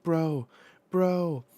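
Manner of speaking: high, loud repeated cry; calling out. Acted. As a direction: call urgently to a hurt friend. Acting out calls to injured friend.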